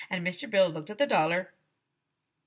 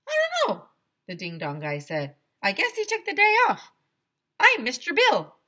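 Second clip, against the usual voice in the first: high, loud goofy ice cream man; high Mister Bill voice; 7 semitones higher, 8 dB louder than usual. Acted. Ice cream man introduces himself, high loud goofy voice. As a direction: play the cheerful clueless vendor way up high.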